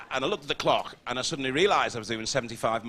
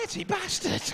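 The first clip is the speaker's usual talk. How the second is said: disgusted exclamation. Acted. Disgusted at the neighbour.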